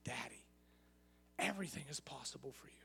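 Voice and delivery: quiet, broken prayer to Daddy; whisper-soft begging, fading away; a tender, hushed plea; soft intimate plea. Retold or imitated. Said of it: "he says".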